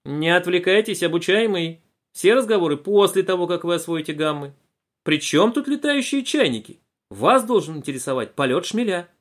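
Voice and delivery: выше и громче, строго; одёрни ученика строго; строгая учительница. A narrator's reading. Преподавательница отвечала. (учительница одёргивает Булочкина, строго и громко)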